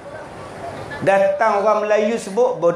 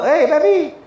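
hard insulting call; 6 semitones higher than usual. Shown as a demonstration. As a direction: bark "Hei, babi" like an insult. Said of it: "tak boleh sebut lembut-lembut".

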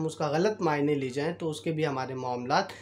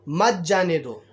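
louder, slower retold scolding; mock-angry reprimand, drawn out and emphatic; angry officer; 6 dB louder, slower than usual. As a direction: retell an officer's anger, stressing "रोको", then "जाने दो". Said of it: "constable को गुस्से से कहा".

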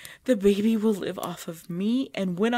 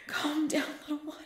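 ordinary talk, nothing marked

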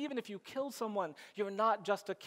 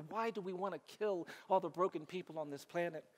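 usual speaking voice

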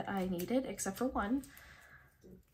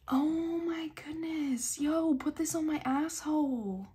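high, drawn-out, delighted exclamation; amazed gushing; 5 semitones higher, 4 dB louder, slower than usual. Real, not acted. Collector gushing over freebie, amazed exclamation. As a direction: stretch the first words out in raised-pitch amazement.

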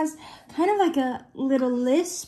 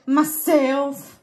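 put-on southern accent. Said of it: "doing a good southern accent there".

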